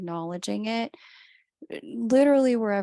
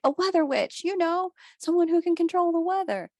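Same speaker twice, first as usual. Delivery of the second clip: excited explaining; 11 semitones higher, 5 dB louder, faster than usual. Retold or imitated. Explaining weather witches to her twin.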